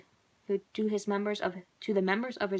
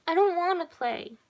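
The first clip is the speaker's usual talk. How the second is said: put-on high, loud child's protest; little girl protesting; 9 semitones higher, 6 dB louder than usual. A narrator's reading. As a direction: refuse like an upset small girl. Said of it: "she told him".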